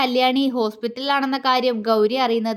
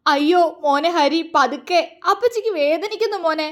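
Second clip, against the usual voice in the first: higher, pleading cry of pain; pained wailing; 5 semitones higher than usual. A narrator's reading. Injured aunt begging Hari to go gently, pained wailing. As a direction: plead as if it hurts, voice raised in pitch. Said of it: "അവർ നിലവിളിച്ചുകൊണ്ട് പറഞ്ഞു".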